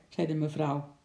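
ordinary delivery

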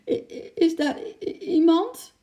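high, stuttering cry; frightened stammering call. A narrator's reading. Sick man calls to a feared intruder, stammering fearfully. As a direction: call out, tripping on the first sounds. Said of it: "riep meneer Hakke opnieuw".

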